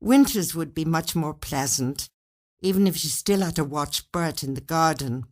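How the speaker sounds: ordinary delivery, nothing marked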